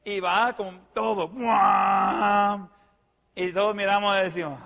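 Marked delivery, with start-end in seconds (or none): 1.3-2.7 loud, drawn-out "bwaaam"; imitated engine roar. Storyteller mimics the crazy driver's pickup charging, loud imitated roar. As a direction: belt out a long vroom of a charging pickup.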